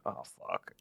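hushed, low, mortified aside; barely voiced, low embarrassed swearing; retold under-breath curse. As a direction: curse under your breath as if just caught out.

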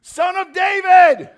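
full-force, high, stretched-out holler; desperate, drawn-out yell. Acted. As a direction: shout over everyone trying to silence you. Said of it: "But he only shouted louder".

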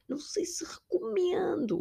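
high, breathy whispered aside; teasing whispered verdict; sly whisper. Real, not acted. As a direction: drop to a conspiratorial whisper. Sly aside after joke.

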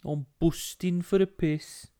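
slow Dudley accent demo. Shown as a demonstration. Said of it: "the Dudley accent is like that".

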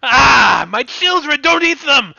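high, loud villain wail; anguished villain shriek. Acted. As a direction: cry out for your dying bugs. Villain sees bugs die.

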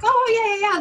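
high, loud fake enthusiasm; mock eager boyfriend. Imitating the boyfriend's loud high eager yeah. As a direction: overplay the eager yes. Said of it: "she's like".

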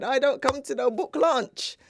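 Mum's emphatic Jamaican refusal, retold; thick Jamaican accent. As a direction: finish the line in broad Jamaican. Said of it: "in a very thick Jamaican accent".

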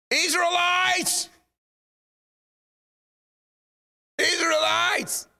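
high, drawn-out shout to a crowd; acted booming film-hero call. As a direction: summon a whole nation, stretching the word high. Acting film Moses calling the Israelites.

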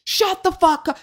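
put-on high, yelled fuck off at the kids; snapping at kids. Shouting up at kids on a roof, snapping at them. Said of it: "and I just snapped".

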